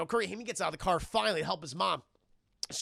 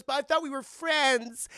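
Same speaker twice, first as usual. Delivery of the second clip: mock hurt plea; 8 semitones higher, 6 dB louder than usual. Voicing mother pleading with son.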